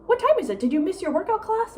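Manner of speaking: high-pitched imitation of mom's questioning; mock high, probing mom voice; inquiring mom. Mimics mom asking about class.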